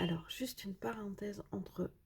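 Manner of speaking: aparté chuchoté, tout bas. Real, not acted. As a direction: glisser la parenthèse en confidence. Aparté chuchoté aux spectateurs. (murmure de confidence)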